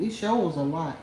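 ordinary talk

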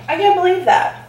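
can't believe it, delighted; amazed. Real, not acted. Surprised by the taste.

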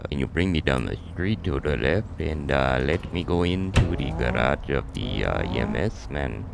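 put-on low, slow accented character voice; put-on foreign character.

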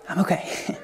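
speaking for the dog in a tiny whisper; a hint of the calmed dog, breathed. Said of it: "she kinda tells me too".

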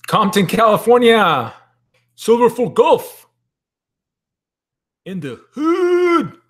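loud, high announcer-like holler; hammed-up shout-out. Host calling out a viewer's hometown, hammed-up high holler. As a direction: whoop it out, drawing the last words long and high.

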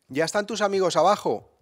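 mother checking if his friends are downstairs; mother asking. Retold or imitated. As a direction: call out the question like a mother. Quoting his mother's question.

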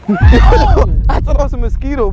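laughing, high and loud. Real, not acted. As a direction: laugh through the words. Laughs after mosquito scare.

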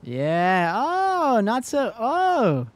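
loud, high victory whoop; triumphant yelling. Real, not acted. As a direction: shout it out, thrilled to win. Celebrating a boss kill.

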